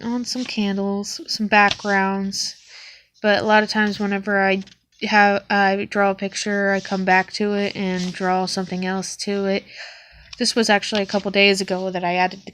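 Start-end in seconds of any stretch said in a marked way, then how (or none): none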